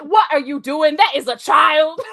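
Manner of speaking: loud imitated reprimand; hammed-up loud outrage; mock scolding. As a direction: scold loudly, as the TV character. Acting out a scolding from show.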